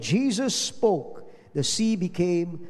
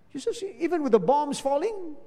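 high, incredulous question; high incredulous question about the bombs; astonished question; 8 semitones higher than usual. Retold or imitated. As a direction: ask in disbelief. Surprised question to old lady.

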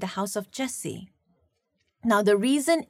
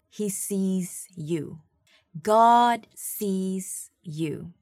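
slow emphasis; 4 semitones lower, much slower than usual. Real, not acted. Slowed sermon point.

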